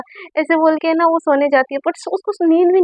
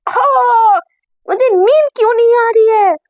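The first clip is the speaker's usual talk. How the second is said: frustrated outburst; 8 semitones higher, 4 dB louder than usual. Acted. Sunaina can't sleep, frustrated.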